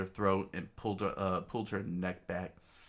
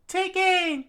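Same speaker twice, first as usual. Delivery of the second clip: much higher, much louder order; screamed command; 10 dB louder than usual. Acted. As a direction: bark the order to fire. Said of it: "screams out".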